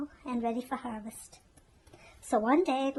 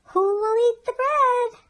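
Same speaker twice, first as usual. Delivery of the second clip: high, loud call to the whole barnyard; 12 semitones higher, 13 dB louder than usual. A narrator's reading. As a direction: hen calls everyone out of habit. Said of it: "the red hen called".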